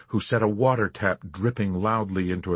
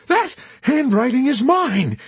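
much higher, louder, slow insistence; passionate; 8 dB louder than usual. Acted. Chaplain defending himself, passionate. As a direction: insist with all your heart. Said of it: "he maintained passionately".

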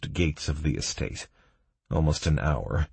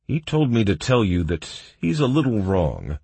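mildly happy, higher-pitched report; a touch of pleased brightness; happily informing; 5 semitones higher, 4 dB louder than usual. A narrator's reading. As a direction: pass on good news cheerfully. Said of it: "the guard happily informed me".

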